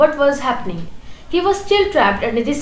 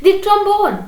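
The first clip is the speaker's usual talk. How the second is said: higher, louder firm insistence; wife insisting firmly on the trombone; decisive; 7 semitones higher, 4 dB louder than usual. A narrator's reading. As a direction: settle it firmly. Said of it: "his wife repeated decisively".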